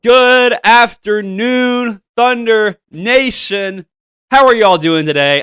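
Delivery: drawn-out, high, loud welcome call; big host greeting. Real, not acted. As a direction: stretch out the greeting like opening a show. Host greets audience, show opening.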